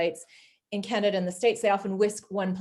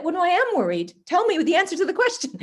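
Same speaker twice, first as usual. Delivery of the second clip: higher, insistent mock protest; mock exasperation; 10 semitones higher, 5 dB louder than usual. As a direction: demand the answer in a hammed-up fluster. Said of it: "I'd be like".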